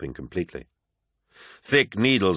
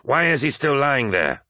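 raised-pitch, insistent question; demanding; 7 semitones higher than usual. A narrator's reading. First Captain to apothecary over the wounded War Master, demanding. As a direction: press hard for an answer. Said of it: "demanded Abaddon".

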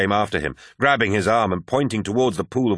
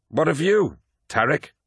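high-pitched, pointed question; challenging; 9 semitones higher than usual. Acted. Abaddon challenges Tarik's loyalty, pointed. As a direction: put him on the spot, pitched up.

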